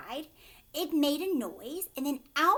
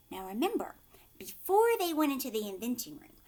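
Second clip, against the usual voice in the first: usual speaking voice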